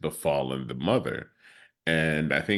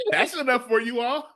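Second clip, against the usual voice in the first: teasing mock. Real, not acted. Mocking teasing reply.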